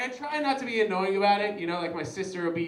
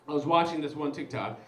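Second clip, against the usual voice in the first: normal talking voice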